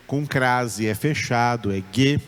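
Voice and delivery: usual speaking voice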